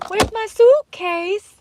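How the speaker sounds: put-on high, slow Slovakian-tinged child voice; Barron Trump impression. Imitating Barron's viral clip, high slow accented child voice. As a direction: do the viral kid asking for his suitcase. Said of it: "Barron Trump".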